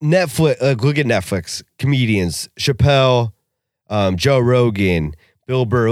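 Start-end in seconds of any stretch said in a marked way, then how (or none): none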